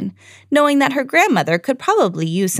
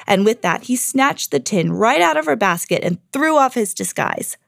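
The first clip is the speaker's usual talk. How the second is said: ordinary delivery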